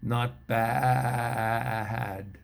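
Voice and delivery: goat bleat on a long, drawn-out "bad". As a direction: bleat it out like a goat, stretching the vowel. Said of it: "one of the goats said".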